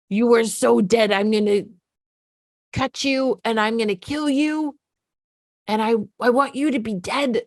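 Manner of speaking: a hint of a furious little girl threatening; daughter's threats. Daughter threatening her mom, mild mock menace. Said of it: "she's just saying to me".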